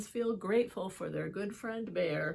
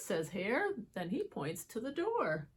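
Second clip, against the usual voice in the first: ordinary delivery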